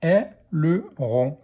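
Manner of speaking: slow "Aileron", split into è, le, ron; syllable by syllable. Shown as a demonstration. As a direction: sound out "Aileron" in three beats. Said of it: "Première syllabe".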